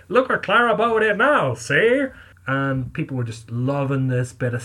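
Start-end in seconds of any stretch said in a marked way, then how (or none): 0.1-2.1 put-on loud, higher old-time newsboy shout; old-timey newsboy. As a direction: hawk the scandal like a street newsboy. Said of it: "an open newspaper saying".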